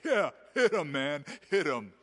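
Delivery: hammed-up shout of a brute urging blows; mock cheering. Mocking someone who cheers on beating a child, shouted.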